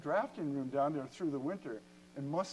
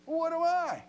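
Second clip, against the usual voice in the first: higher, louder voicing of the engineer asking; eager question; 13 semitones higher, 6 dB louder than usual. Head of engineering asking what her pirate job is, eager and higher. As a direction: pitch it up like a keen colleague.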